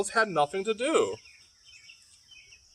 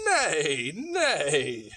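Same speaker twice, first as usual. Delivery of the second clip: horse neigh; 3 semitones lower than usual. Acted. Librarian hears horse.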